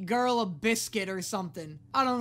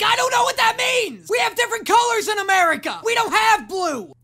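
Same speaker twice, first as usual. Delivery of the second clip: frantic shouted excuse, much higher and louder; panicked yell back; 10 semitones higher, 12 dB louder than usual. Retold or imitated. I yell back at the honking driver, panicked shouting. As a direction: yell back in helpless confusion. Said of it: "And I just turn around like".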